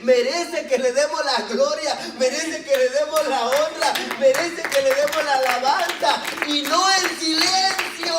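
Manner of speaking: mucho más agudo y fuerte, con risas; alábalo a gran voz; proclamando a gritos. Acted. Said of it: "¿Por qué me voy a callar?". (el leproso sanado responde al hermano gritando alabanzas)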